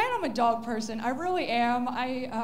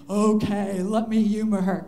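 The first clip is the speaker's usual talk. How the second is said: weary cat; 5 semitones lower, 9 dB louder than usual. Pretend. Cat gives in.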